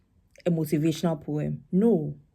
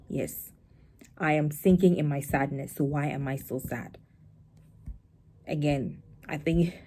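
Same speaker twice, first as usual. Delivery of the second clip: ordinary talk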